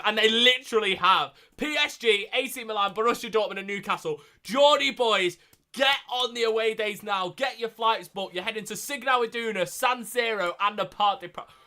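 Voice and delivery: loud, high, thrilled fan rant; fired-up shouted hype; excited rallying. Real, not acted. Rallies Geordie fans after draw.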